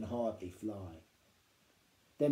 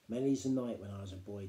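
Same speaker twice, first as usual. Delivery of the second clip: usual speaking voice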